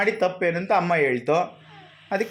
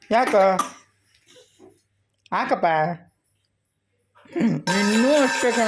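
crying; 3 semitones higher, slower than usual. Real, not acted. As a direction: wail the words slowly through tears. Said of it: "ಅದಕ್ಕೂ ಅಳ್ತೀಯಾ?".

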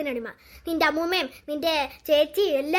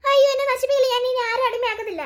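mock pleading; 5 semitones higher than usual. Ghost begging.

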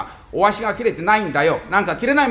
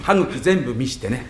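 ordinary delivery

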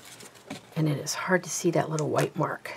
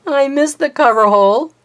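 exasperated exclaim; 8 semitones higher, 12 dB louder than usual. Real, not acted. Misses hole, laughing exasperation.

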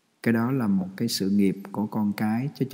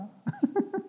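soft laugh; 6 dB quieter than usual. Real, not acted.

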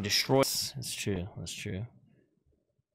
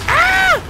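startled yell; 15 dB louder than usual. Real, not acted. Sudden kill in game.

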